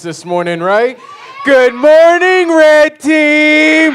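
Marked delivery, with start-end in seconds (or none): 1.5-3.9 drawn-out shout. Acted. Leader cueing the kids' greeting.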